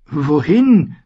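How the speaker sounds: high-pitched bewildered question; confused. A narrator's reading. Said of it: "fragte Sam verwirrt".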